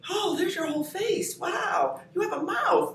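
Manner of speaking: high, delighted mock shout; excited greeting. Greeting people without masks, in high delighted surprise. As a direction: squeal it like seeing a face for the first time.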